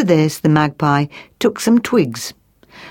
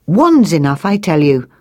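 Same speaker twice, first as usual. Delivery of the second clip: plain reading voice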